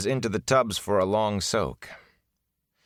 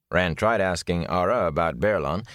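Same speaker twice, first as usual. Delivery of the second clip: plain reading voice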